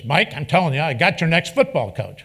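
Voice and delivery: insistent imitation. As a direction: hammer it home like a stubborn coach.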